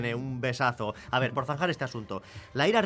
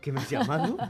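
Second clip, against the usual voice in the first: laughing mock outrage. Mock offense while laughing.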